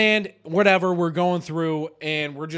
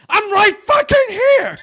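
high, loud, stretched-out shout, reenacted; exasperated yell; 10 dB louder than usual. [name] answering Jake's repeated calls, exasperated yell. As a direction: yell back at a nagging teammate. Retelling own exasperated reply.

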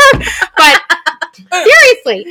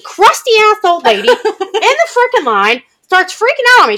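ordinary talk

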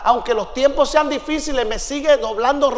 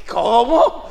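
acted incredulous questions with sharp rises; indignant disbelief; much slower than usual. Visitor told to keep it short, indignant disbelief. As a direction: react as if you can't believe it. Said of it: "me dio un santo coraje".

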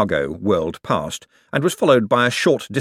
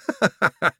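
giddy laugh; 10 semitones higher than usual. Acted. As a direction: giggle growing into a laugh.

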